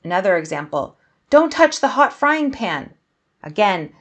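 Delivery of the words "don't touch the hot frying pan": loud, high-pitched cry of alarm; urgent warning. Shown as a demonstration. Scared onlooker warning someone off a hot pan, urgent warning. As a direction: warn them urgently before they get hurt. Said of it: "there's a sense of urgency".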